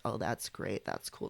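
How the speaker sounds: soft, drowsy Paris Hilton-style murmur; lazily tossed off, half asleep; Zoomer sleepy register. Retold or imitated. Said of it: "Zoomer sleepy register".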